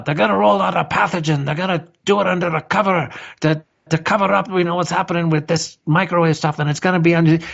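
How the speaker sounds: high, fast, frantic mock warning; imitating Mark. Host mimics guest Mark predicting a pathogen, high and rushed. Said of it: "if you had said to us".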